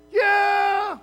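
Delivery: high, loud battle yell; war cry. Retold or imitated. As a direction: roar it like troops charging the line. Israelites' war cry at battle line.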